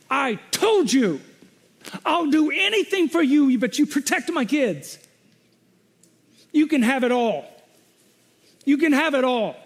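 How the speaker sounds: high, loud, pleading bargain with God; desperate pleading prayer. Retold or imitated. Pastor recalling begging God to protect his kids, loud pleading. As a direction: beg the Lord, voice pushed up high.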